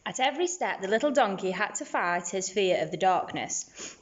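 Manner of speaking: plain reading voice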